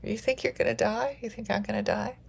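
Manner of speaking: high-pitched mock questioning; acted-out incredulous reply; imitating alarmed loved ones. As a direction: pitch it way up, as startled listeners would. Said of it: "They'll go like".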